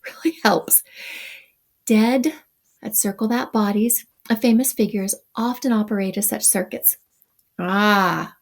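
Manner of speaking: ordinary delivery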